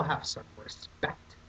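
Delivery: whispered mock scolding. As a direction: hiss it under your breath. Scolding aside to signer.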